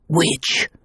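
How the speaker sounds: hissed, voiceless accusing word; whispered accusation. Acted. Voice from the past whispering the accusation. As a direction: breathe it like a curse.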